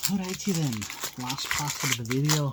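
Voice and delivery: put-on playful, half-slipped Scottish or Irish accent. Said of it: "slip into an accent like Scottish or Irish".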